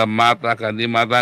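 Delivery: ordinary talk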